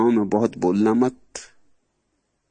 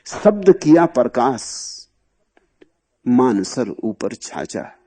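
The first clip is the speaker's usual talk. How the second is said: verse recitation; slower than usual. Shown as a demonstration.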